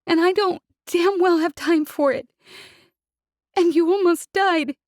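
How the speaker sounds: tearful outburst; sobbing. Acted. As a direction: sob out the words. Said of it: "she sobbed".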